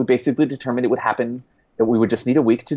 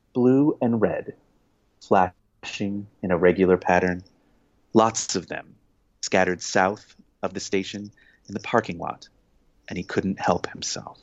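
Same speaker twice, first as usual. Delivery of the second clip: slow, low, controlled reading; audiobook narration voice; 4 semitones lower, 3 dB quieter than usual. Shown as a demonstration. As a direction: read it slowly and keep the voice low and controlled. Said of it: "You have to do it like this".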